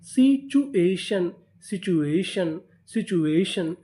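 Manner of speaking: very slow, syllable by syllable; teacher stretching the new word slowly; slow spelled-out reading. Shown as a demonstration. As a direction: sound out the word for learners. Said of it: "तो पूरी meaning क्या बनी?".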